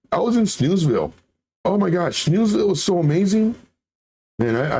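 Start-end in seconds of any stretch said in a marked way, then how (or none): 0.1-3.5 mock giddy excitement. Jokes about napping to chat.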